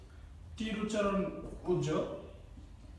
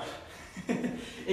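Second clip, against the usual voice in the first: chuckle; 7 dB quieter than usual. Real, not acted. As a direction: chuckle softly at your own joke. Laughs at own acronym joke.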